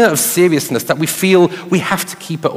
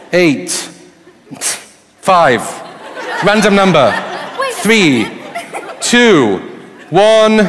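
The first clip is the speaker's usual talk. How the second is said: on stage; 4 semitones higher, 3 dB louder, slower than usual. Real, not acted. Calling out a timed countdown.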